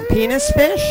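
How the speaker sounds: squeaked way up high; high squeal. Real, not acted. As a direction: squeal the punchline. Punchline squeal.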